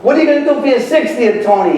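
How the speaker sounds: quick, high-pitched heckle from the crowd; mock yelled question. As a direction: imitate someone calling out a question. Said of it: "somebody yelled out".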